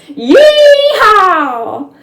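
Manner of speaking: very high, very loud whooping yell; galloping cowboy's screaming war cry. Acted. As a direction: let rip a wild charging cowboy whoop. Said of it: "shouting out strange cowboy war cries".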